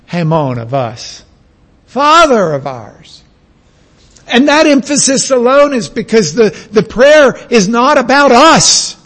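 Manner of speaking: ordinary delivery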